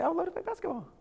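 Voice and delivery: high, sing-song overeager yes to the wife; eager husband falsetto. Retold or imitated. Husband to wife about the trash, high overeager reply. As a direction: sound thrilled to be asked, in a falsetto. Eager dad answers son.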